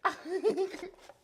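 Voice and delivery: loud high-pitched cackle; high shrieking laugh. Real, not acted. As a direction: burst into a squealing laugh. Laughs at weird voice.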